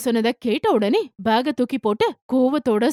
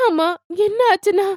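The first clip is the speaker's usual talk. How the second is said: higher, louder tearful call to mother; crying; 8 semitones higher, 3 dB louder than usual. A narrator's reading. Daughter running to mother in tears, higher and louder. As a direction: cry out to mom in tears. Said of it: "அழுதுகிட்டே".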